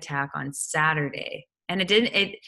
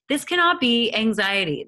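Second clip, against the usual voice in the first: panicked denial; 6 semitones higher than usual. Retold or imitated. Panicking, denying anxiety.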